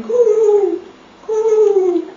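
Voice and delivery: loud cooing pigeon. Pretend. Mother to baby at the pigeon picture, loud cooing. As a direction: coo it out like a pigeon. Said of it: "It's going".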